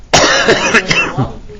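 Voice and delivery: short rough chesty cough. Real, not acted. Teacher coughs again.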